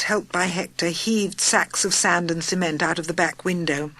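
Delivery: usual speaking voice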